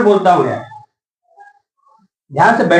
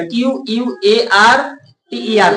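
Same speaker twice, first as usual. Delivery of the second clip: slow spelling; slower than usual. Shown as a demonstration. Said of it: "spelling out quarter again".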